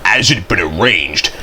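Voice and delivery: ordinary delivery, nothing marked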